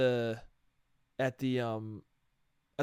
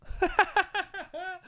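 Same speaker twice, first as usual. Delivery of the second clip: high laugh. Real, not acted.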